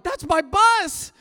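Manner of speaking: high, slow loud yell; boy yelling after a bus. Retold or imitated. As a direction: shout after the leaving bus. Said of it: "I start yelling".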